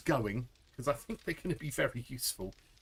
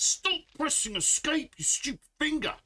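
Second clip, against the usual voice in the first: high, loud scold at her own finger; exasperated yell; 13 semitones higher, 3 dB louder than usual. Real, not acted. Streamer yelling at her own finger after pressing escape. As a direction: snap at the finger in frustration.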